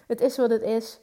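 hik breekt de zin; met de hik. Real, not acted. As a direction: zeg het terwijl je hikt. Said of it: "Ik heb de hik". (met de hik)

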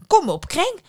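high, loud command; urging mother. Acted. Mother orders her daughter into the well, loud urging.

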